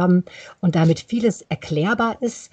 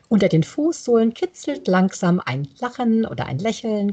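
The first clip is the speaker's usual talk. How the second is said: ordinary delivery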